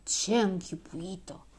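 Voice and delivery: slow, scornful grumble; disdainful mutter. A narrator's reading. Boy dismissing the girl who teased him, muttering scornfully. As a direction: grumble it slowly with contempt. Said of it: "mormăi cu dispreț".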